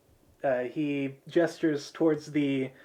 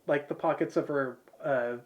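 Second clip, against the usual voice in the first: ordinary talk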